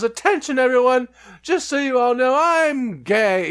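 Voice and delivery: very high sing-song announcement; camp announcement. Retold or imitated. Jim Carrey's character announcing to the whole party, very high. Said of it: "And he goes".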